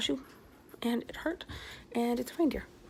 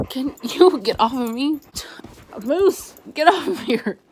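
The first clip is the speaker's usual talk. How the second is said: raised voice, high and loud, ordering the dog off; exasperated scolding; 4 semitones higher, 10 dB louder than usual. Real, not acted. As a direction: shout at your dog to get off you. Scolding dog climbing on her.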